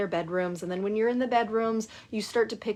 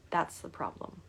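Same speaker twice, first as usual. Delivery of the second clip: hushed; 3 semitones lower, 8 dB quieter than usual. Retold or imitated. Coach points out the problem.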